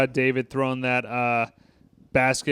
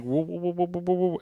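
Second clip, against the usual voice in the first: soft rising woo-woo like an alien theme; eerie whoo sound; 4 semitones higher, 6 dB quieter than usual. Shown as a demonstration. Describing the score by mimicking an eerie UFO sound, softer. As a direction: imitate a spooky UFO tone. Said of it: "sounds like a UFO".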